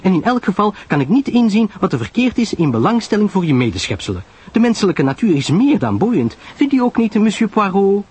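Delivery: high, animated society chatter; Pamela Lyle, self-justifying. Acted. Pamela appeals to Poirot for support, high and insistent. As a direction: argue brightly, appealing at the end. Voices Pamela defending her hobby.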